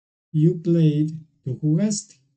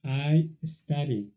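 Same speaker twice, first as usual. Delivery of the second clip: slow English model; 6 dB quieter, much slower than usual. Shown as a demonstration. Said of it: "le quito esta y le agrego I E D".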